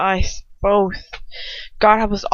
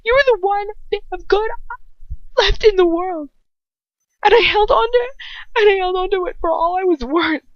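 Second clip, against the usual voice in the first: high, loud, tearful pleading; sobbing; 10 semitones higher, 4 dB louder than usual. A narrator's reading. Hermione pleading with Ron through tears, sobbing. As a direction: beg through sobs. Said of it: "I sob".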